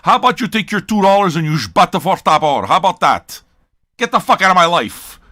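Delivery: loud, scornful brush-off. Acted. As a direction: tell the cheapskates to get lost. Ranting at stingy would-be patrons.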